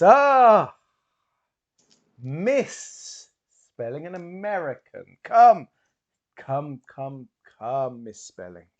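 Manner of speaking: loud, high stage-magician greeting; grand showman calling her up; booming theatrical hypnotist. Acted. GM voices the hypnotist Bowman.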